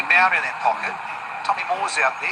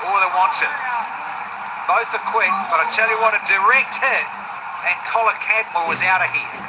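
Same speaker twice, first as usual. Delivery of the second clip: louder, urgent live call; excited run-out scare; 3 semitones higher, 4 dB louder than usual. Real, not acted. Commentator calling a quick two and a near run-out, excited. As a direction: punch out the near miss as it happens.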